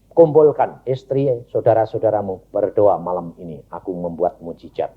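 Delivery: low, measured divine instruction; lightly voiced God. God instructing the father at night, low command. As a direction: deliver the command low, lightly in God's role.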